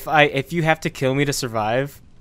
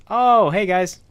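excited greeting; 5 semitones higher, 5 dB louder, slower than usual. Acted.

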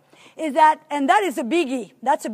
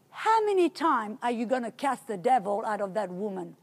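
soft, lower, gentle rebuke; 6 semitones lower, 8 dB quieter than usual. Retold or imitated. Holy Spirit gently questions the preacher, soft and low. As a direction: ask it gently, like a patient father. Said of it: "gently say".

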